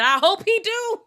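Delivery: high and loud, a mocking sung-out hope; mock hopeful squeal. Mocking the husband's promise, high loud squeal. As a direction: squeal it up high like you doubt it.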